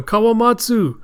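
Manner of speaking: high, loud cry of a name; calling out. Acted. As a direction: call to someone while running over. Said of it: "Kiku goes running off going".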